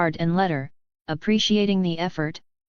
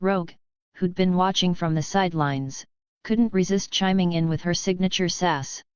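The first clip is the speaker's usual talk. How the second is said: usual speaking voice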